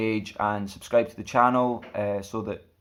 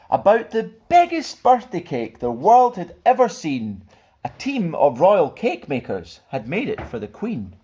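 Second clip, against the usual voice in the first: lively, sing-song storybook reading; children's story narration; 6 semitones higher, 4 dB louder than usual. A narrator's reading. Stuart reading a picture book to children, lively. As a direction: read it like bedtime storytime for kids.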